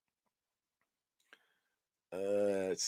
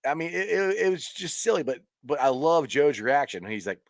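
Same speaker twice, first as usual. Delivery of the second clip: ordinary talk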